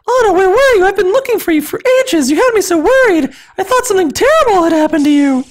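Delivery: high falsetto, relieved and fretful; squeaky relieved voice; worried big sister. Acted. Elsa scolding lost Anna in a high, worried falsetto.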